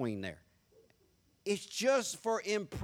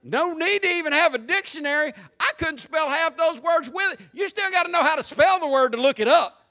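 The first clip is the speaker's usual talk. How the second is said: loud, high-pitched comic rant; full-voiced preacher's outburst, pitched high; exasperated shouting; 9 semitones higher, 10 dB louder than usual. Real, not acted. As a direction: holler it out in mock despair. Comic rant about big words.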